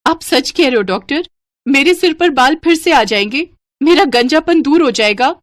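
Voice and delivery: high, loud, excited questioning; amazed, hopeful patient. Acted. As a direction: ask the doctor in high, disbelieving hope. Voices hopeful bald patient.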